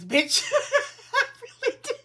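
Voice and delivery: laughing out the insult, pitch leaping way up; laughing curse. Real, not acted. Tarot reader cursing viewer's mother while laughing.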